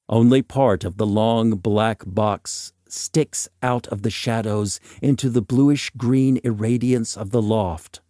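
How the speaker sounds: ordinary delivery, nothing marked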